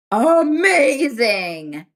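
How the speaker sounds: drawn-out amazing. Real, not acted. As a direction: savour the word with delight. Praising her thirties.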